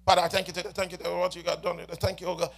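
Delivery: soft, quick mumbled prayer said by rote; rushed lip-service prayer. Shown as a demonstration. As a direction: rattle off a prayer without meaning it. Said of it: "just praying with your lips".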